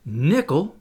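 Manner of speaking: mildly startled exclamation of a name; startled cry. A narrator's reading. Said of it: "cried Michel Ardan".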